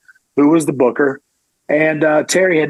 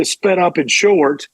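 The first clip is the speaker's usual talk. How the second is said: ordinary delivery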